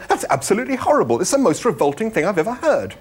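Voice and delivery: fast, high-pitched revulsion; appalled exclamation. A narrator's reading. Arthur reacting to the willing animal, appalled exclamation. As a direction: protest in high, rushed horror. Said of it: "exclaimed Arthur".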